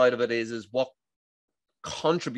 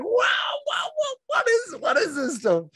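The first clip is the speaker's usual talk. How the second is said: much higher, louder, cry of amazement; amazed shout; 5 dB louder than usual. Retold or imitated. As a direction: shout out in wonder. Amazed by the Dutch method.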